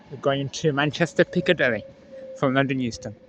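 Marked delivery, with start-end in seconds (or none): none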